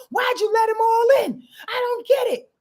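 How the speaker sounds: high, loud, fed-up griping; exasperated complaint. Acted. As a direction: demand an answer, baffled and annoyed. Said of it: "This is Aquarius talking".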